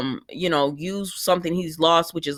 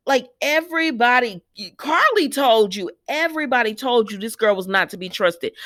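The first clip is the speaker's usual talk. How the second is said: ordinary delivery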